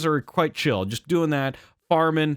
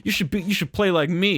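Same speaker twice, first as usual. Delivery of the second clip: smug advice; 5 semitones higher than usual. Retold or imitated. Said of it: "I was like".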